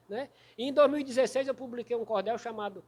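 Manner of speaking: ordinary delivery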